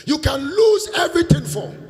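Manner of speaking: high, loud repeat for emphasis; emphatic. Acted. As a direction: drive the point home loudly. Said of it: "I'm telling you this".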